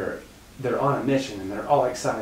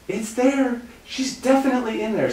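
high falsetto imitation; hammed-up girlish alarm; mock urgent friend; 12 semitones higher, 4 dB louder than usual. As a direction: announce it like the girl leading a rescue. Retold leader's urgent announcement.

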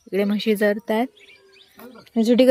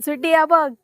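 calling the pet; 6 semitones higher than usual. Real, not acted. Calling the dog.

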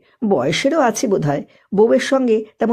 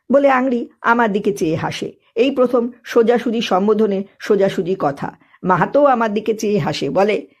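usual speaking voice

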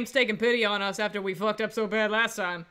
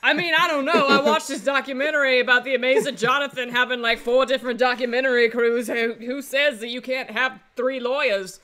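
ordinary talk, nothing marked

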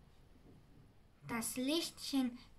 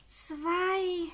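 higher, reproachful creature count; 7 semitones higher, 6 dB louder than usual. A narrator's reading. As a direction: count it as a rebuke. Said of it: "sagte das Sams vorwurfsvoll". (vorwurfsvoll gezählt)

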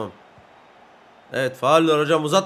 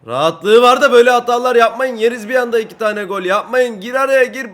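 loud, high scolding of teammates; urgent shouting; 6 semitones higher, 8 dB louder than usual. Real, not acted. Player warns sloppy defenders at two-nil, urgent shouting. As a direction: shout orders in alarm. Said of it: "Öyle bağırınca tuttu bak".

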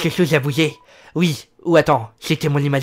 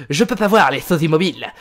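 hammed-up lisp with bursts of loudness; lisping dog boss; 4 dB louder than usual. Dog boss warns any human who moves, lisping threat. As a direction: play a menacing little boss with a lisp. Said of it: "j'adore lui faire genre qu'il a un feu sur la langue".

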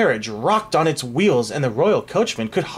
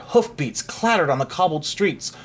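plain reading voice

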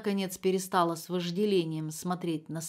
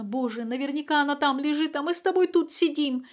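higher and louder, rising in terror; horror-stricken; 7 semitones higher, 4 dB louder than usual. A narrator's reading. Mother terrified her daughter lies in the bathroom. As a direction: let the horror climb. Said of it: "затряслась от ужаса".

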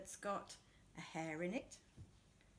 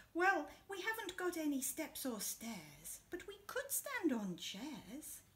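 higher, eager little Hare; storyteller's higher Hare voice; Hare's voice; 5 semitones higher than usual. Acted. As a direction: make Hare bright and clever, pitched up. Hare voiced in picture book.

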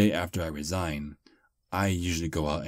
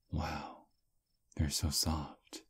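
tender murmur; 7 dB quieter, much slower than usual. Acted. Holding the listener's hand tenderly.

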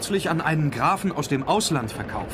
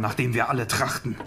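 ordinary delivery

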